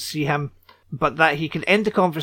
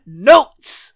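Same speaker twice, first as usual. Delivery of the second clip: loud, higher announced title; jingle shout; 6 semitones higher, 11 dB louder than usual. Pretend. Finishing the notes theme for co-host with a loud call. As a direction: shout the segment name like a jingle. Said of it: "play the notes section theme".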